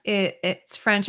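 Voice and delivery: ordinary talk, nothing marked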